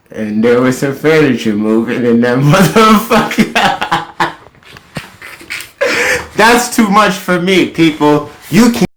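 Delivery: high, loud words through laughter; laughing hard. Real, not acted. Laughing at his own story, high and loud. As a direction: crack up mid sentence.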